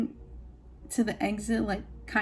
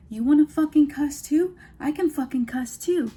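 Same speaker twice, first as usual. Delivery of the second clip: raised, combative mock outburst; not calm boss; 4 semitones higher, 4 dB louder than usual. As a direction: play the boss losing his cool, pitched up. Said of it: "instead of being, like, calm, cool".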